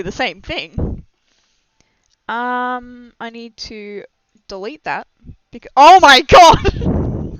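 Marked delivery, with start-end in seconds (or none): 5.8-7.4 loud, high cry of shock; startled outburst. Real, not acted. Cuts off their own sentence in shock, loud startled outburst. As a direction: burst out in sudden disbelief.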